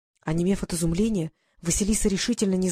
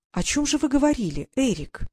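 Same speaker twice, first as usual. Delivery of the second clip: higher-pitched, coaxing question; insinuating; 6 semitones higher than usual. A narrator's reading. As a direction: coax it out of the boy. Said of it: "вкрадчиво спросила она".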